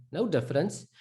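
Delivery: usual speaking voice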